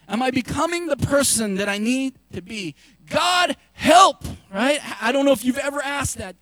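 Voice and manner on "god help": loud, high plea for help; desperate cry to God. Pretend. Pastor voicing a desperate prayer, crying out loud.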